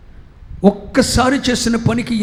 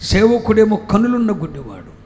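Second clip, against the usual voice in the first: ordinary talk, nothing marked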